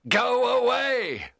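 high, loud, drawn-out cry; cried out. A narrator's reading. Frankenstein drives the monster away, crying out. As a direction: fling the words at him. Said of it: "I cried".

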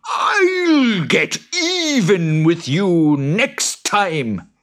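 very high, very slow creature threat; high boggart vow of revenge; vengeful boggart. Acted. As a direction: promise revenge with a snarl.

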